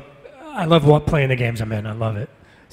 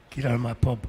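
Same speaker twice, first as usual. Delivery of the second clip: lightly voiced tough guy, softer; Wolverine; 5 dB quieter than usual. Actor repeating his joke line, quiet tough voice. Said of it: "Now I sound like Wolverine".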